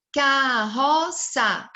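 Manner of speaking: CARROÇA very slowly as "Ca... rro... ça"; syllable by syllable. Shown as a demonstration. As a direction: stretch and separate each syllable of Carroça. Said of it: "Então, olha só".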